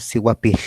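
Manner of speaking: "sihuapil" ending in a long lateral hiss; voiceless final L. Shown as a demonstration. Teacher shows air leaving by the sides, "sihuapil" with voiceless L. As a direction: blow air round the tongue for the L of "sihuapil". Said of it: "el aire sale por los lados".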